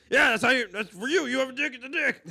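loud, high Texas dad; hammed-up Texan bluster; put-on Texas accent. Dad answering kid, mock Texas bluster. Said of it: "My dad definitely wasn't from Texas".

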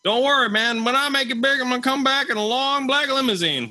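high-pitched put-on voice, held up high; high, confident mock voice; acted boast. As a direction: play a big talker promising his return. Acted quote of a man's boast.

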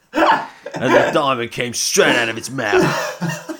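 slow, amused mock narration; laughing. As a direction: tell it while cracking up. Riffing on absurd story, cracking up.

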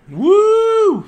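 loud, very high whoop; excited cheer. Real, not acted. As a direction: whoop with delight. Cheering the movie title.

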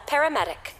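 ordinary talk, nothing marked

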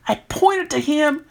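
ordinary delivery

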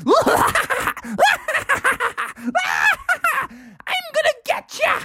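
high cackling threat; high evil skeleton cackle; evil laugh. A narrator's reading. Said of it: "an evil laugh".

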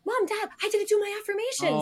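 put-on high little girl voice, alarmed; child voice. Mother imitates her daughter in a high child voice. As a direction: play the three-year-old remembering her affirmations. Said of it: "she will literally be like".

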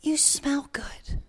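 slow, hushed whisper; retold tearful whisper. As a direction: imitate her barely whispered words. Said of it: "she's in tears".

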